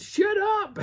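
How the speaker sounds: high, loud mock yell; mock a woman silencing her dogs; high-pitched exasperated shout; irate woman scolding. Retold woman scolding barking dogs.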